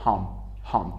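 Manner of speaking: hump, final p barely sounded; very short P. Shown as a demonstration. Teacher explains the faint p, very short p ending hump. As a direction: say hump with the p almost swallowed.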